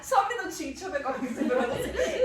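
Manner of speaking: giggly, high-pitched excuse; imagined aside while giggling. Pretend. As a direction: play asking for a moment, barely holding back laughter.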